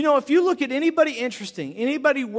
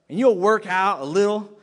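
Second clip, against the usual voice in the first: normal talking voice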